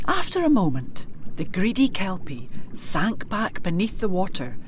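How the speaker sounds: ordinary delivery, nothing marked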